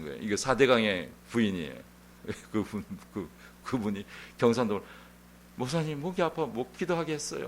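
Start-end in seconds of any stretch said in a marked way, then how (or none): 5.6-7.5 imitating a Gyeongsang dialect complaint, higher and soft; lightly put-on Gyeongsang dialect, pitched up. A church member telling the pastor her throat hurts, mild Gyeongsang dialect. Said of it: "경상도 말로 이렇게 하더라고요".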